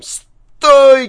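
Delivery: very high, loud scream of command; wild, panicked bellow up high; frantic shout. A narrator's reading. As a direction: yell it in a voice not your own. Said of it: "кричит не своим голосом".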